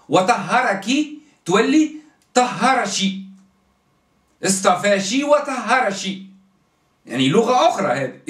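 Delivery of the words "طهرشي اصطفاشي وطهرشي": طهرشي and اصطفاشي, ك said as شي, slowly; slow demo of اصطفاشي وطهرشي with ش endings; dialect pronunciation. Shown as a demonstration. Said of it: "ينطقوا الك ش".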